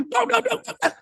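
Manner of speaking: high-pitched, rapid vocal door knocks; urgent rapping noise made by mouth; imitated insistent knocking. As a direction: mimic knocking over and over. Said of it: "Saya ketok lagi".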